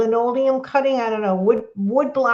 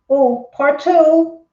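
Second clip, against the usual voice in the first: slow, drawn out, louder; host eagerly reading a viewer's message; excited; 5 semitones higher, 6 dB louder, much slower than usual. Real, not acted. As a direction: stretch it out with eager anticipation.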